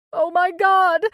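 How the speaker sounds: loud, high tearful outcry; tearful cry. A narrator's reading. Lourdes crying out as Angela does CPR, tearful outcry. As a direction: cry out through tears. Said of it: "cried Lourdes again, tears running".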